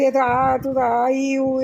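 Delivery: loud, high mock wails; a hammed-up yelp of pain; imitated pain cries. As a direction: copy the child's howling. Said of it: "ऐसे चिल्ला रहा था".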